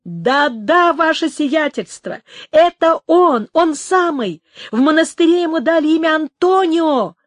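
high, loud, emphatic confirmation; jubilant exclamation. A narrator's reading. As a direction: cry out the good news with full conviction. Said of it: "воскликнул Артуро".

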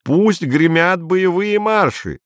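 высоко и медленно, с показным пафосом; изобразить восторженного патриота; насмешливый пафос. A narrator's reading. Изя паясничает, изображая патриота. (издевательски-торжественно)

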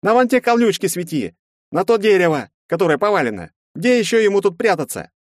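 high, loud impatient orders; grumbling command. A narrator's reading. Герцен directs the searchlight toward the bushes and tree, grumbling. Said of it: "проворчал Герцен".

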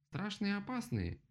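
high-pitched mock yell; shouting. A narrator's reading. Rabbit outdoes the woodpecker, mock shouting. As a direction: cry it out high, without much volume. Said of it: "крикнул кролик так громко".

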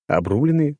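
Обрубленные asked back high, rising in surprise; surprised echo. A narrator's reading. Hero repeating his friend's word, surprised question. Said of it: "удивился я".

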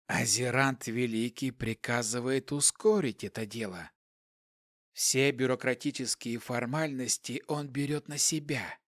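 quiet, emotionless hissing voice. A narrator's reading. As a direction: hiss it softly, without feeling. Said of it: "Шипящий голос не выражал никаких эмоций".

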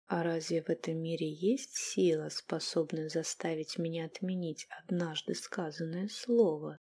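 hushed unvoiced whisper. A narrator's reading. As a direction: breathe it in a whisper. Captive answers in whisper. (шёпотом)

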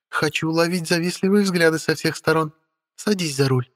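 high, light voice of a spoiled beauty; haughty young woman voice. Acted. Witch Stella speaking. (капризная молодая ведьма)

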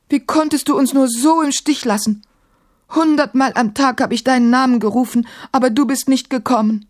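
high-pitched, hurt accusation; aggrieved, pleading complaint; plaintive reproach. A narrator's reading. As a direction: reproach a friend who deserted you. Caliph reproaches djinn who abandoned him.

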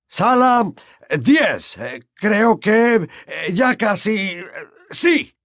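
hesitant searching. Acted.

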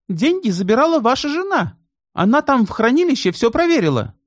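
high-voiced bank clerk; female character. A narrator's reading. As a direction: voice the young woman clerk. (высокий женский голос)

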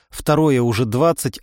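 ordinary delivery

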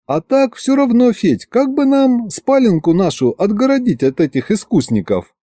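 high-pitched coaxing wife. A narrator's reading. Wife asking husband to fence off their bedroom, coaxing.

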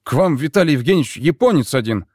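loud call through a door. Acted. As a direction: raise the voice to wake him. Sailor calls through cabin door.

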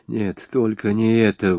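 ordinary delivery, nothing marked

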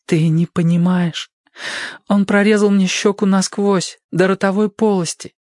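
high, hurt woman's voice, bitter; wounded young woman. Acted. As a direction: voice her bandaged and ashamed. Narrator voices Sadie.

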